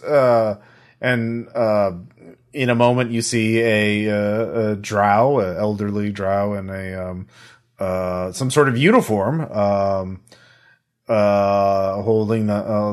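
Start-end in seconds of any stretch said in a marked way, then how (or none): none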